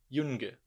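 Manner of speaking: Jung said "yung", hard G end. Shown as a demonstration. Teacher shows students hard G. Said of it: "eu falo Jung".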